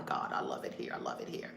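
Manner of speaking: very low, soft near-whisper; hushed delight. Real, not acted. Host savouring Mexico, low hushed aside. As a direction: drop to a low murmur. Savouring her new home.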